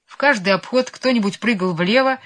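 ordinary delivery, nothing marked

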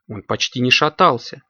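plain reading voice

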